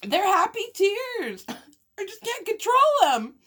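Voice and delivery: high, wobbly laugh-cry; tears and giggles fighting each other; laughing through tears. Real, not acted. Still crying while retelling.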